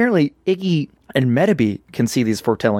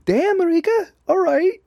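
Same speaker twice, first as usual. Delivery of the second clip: squeaky awe. Retold or imitated. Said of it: "And I'm like".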